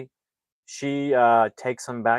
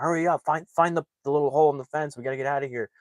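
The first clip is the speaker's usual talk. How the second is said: quick, higher, panicky retold urging; freaking out; 6 semitones higher, much faster than usual. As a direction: hurry them along in a mock panic. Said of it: "so they're freaking out".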